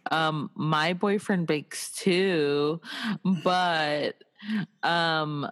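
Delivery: usual speaking voice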